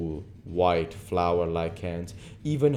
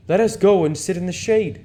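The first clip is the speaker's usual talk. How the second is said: louder, higher, genial aristocrat; Lord Henry voice; 13 semitones higher, 9 dB louder than usual. Acted. Lord Henry inviting Dorian into the shade, genial lord. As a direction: invite him like a charming lord.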